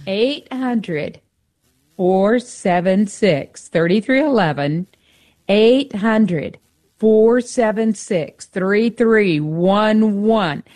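slow, deliberate phone number with pauses between groups; nice and slow. Shown as a demonstration. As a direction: spell out the number slowly so listeners can catch it. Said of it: "I will say it nice and slow".

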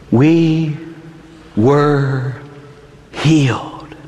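very slow, with long pauses; solemn scripture recitation. Real, not acted. Preacher reciting Isaiah over the scourged Jesus, solemn slow recitation. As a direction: weigh every word of the prophecy.

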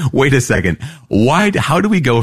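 mock bewilderment, pitch jumping up; baffled protest. Lecturer voicing puzzled readers of Isaiah, mildly incredulous.

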